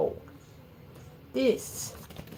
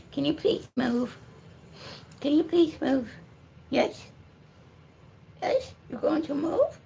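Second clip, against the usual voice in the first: high-pitched pet talk; higher, cajoling voice for a pet; coaxing; 8 semitones higher than usual. Real, not acted. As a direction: plead sweetly with the dog to move. Coaxing the dog to move.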